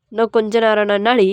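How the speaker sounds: firm, full-voiced retort; scolding husband. Husband waking his wife, mildly scolding. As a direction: throw the sleepy plea back at them. Husband throws wife's words back.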